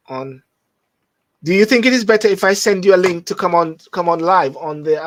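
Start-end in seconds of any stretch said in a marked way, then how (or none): 1.4-5.1 louder and faster, calling to the caller. Real, not acted. Host calls out to a caller, loud and quick. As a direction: raise the voice and rush the offer.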